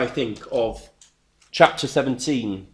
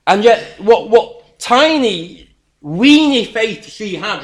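emphatic; 8 semitones higher, 11 dB louder, slower than usual. Acted. Preacher mocking her small faith.

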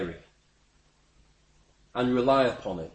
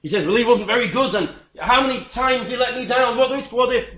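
from a hushed oh no up to high, louder fretting; imagined panic; 7 semitones higher, 4 dB louder than usual. Retold or imitated. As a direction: worry building into a panicked rush. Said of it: "I'll think".

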